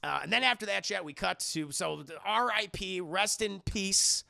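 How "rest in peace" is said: slow, drawn-out rest in peace; a mock-solemn "rest in peace", drawn out; solemn farewell. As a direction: lay "rest in peace" out slowly like a eulogy. Solemn slow eulogy for Randy.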